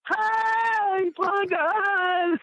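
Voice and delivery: high mock shriek of panic; shrieking girl. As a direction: yell it like a frightened woman seeing the gun. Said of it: "you could hear that big, heavy-set girl again".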